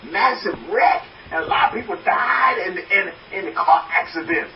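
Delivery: high, insistent imitation of a fretful mother; worried mother. Mother warns her son off buses and cars, worried and high. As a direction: protest emphatically, acting out an anxious mom.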